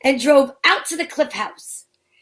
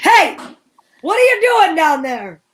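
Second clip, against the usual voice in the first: calling out; 3 semitones higher, 4 dB louder than usual. Acted. Officer calling down from road.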